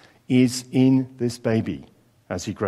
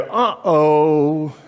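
mock worried uh-oh; a comic little gulp of alarm; playing the hearer who gets the code; 3 semitones higher, 4 dB louder than usual. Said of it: "and you go".

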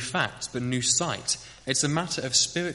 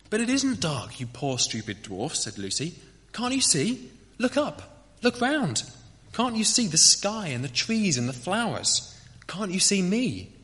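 put-on pleading girl; 6 semitones higher than usual. Lucy pleads with blind dwarves.

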